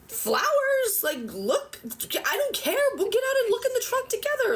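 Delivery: letting the pent-up frustration spill out, voice climbing high; upset exasperated outburst. Retold or imitated. A woman retelling how she exploded at a cop over her trunk, high and exasperated. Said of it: "Why are you so upset?".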